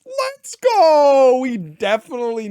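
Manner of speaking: very high, loud cheering; excited cheer. Real, not acted. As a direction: shout with pure joy. Celebrating the opponent's tails flip.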